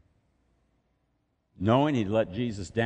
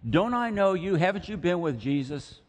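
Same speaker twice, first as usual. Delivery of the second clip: high, louder suspicious challenge; a servant girl calling him out, pitched up; girl pointing at Peter, suspicious and high; accusing servant girl; 9 semitones higher, 3 dB louder than usual. Retold or imitated. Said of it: "and she thinks".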